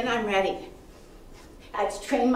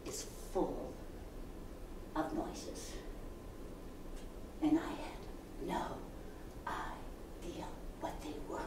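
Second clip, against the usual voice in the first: soft whispered mystery; hushed whisper; 6 semitones lower, 20 dB quieter than usual. A narrator's reading. Telling the audience about the noises, hushed whisper. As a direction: drop to a secretive whisper.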